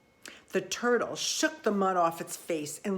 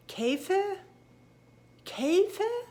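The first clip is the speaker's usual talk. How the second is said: puzzled repeat; 12 semitones higher, slower than usual. Acted. Puzzled frog repeats strange word.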